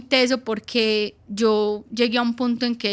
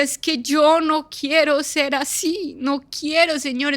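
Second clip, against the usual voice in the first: slow, higher, tearful plea to God; retold tearful plea; 5 semitones higher, slower than usual. Mother crying to the Lord, ashamed of her anger, slow tearful plea. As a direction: beg the Lord through held-back tears. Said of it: "yo me iba a llorar y yo decía".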